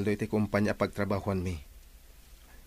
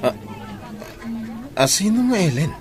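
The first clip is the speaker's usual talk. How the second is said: surprised; 9 semitones higher, 4 dB louder than usual. Acted. Caught off guard by a name.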